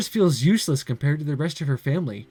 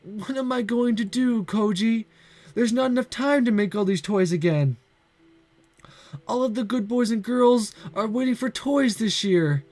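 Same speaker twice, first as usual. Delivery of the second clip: tearful; 7 semitones higher than usual. Acted. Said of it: "Big teardrops fall".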